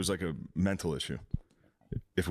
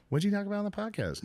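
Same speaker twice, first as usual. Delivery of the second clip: put-on girlfriend voice; 10 semitones higher, faster than usual. Imitating girlfriend on phone.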